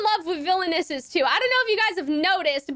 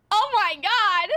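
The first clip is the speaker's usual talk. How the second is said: normal talking voice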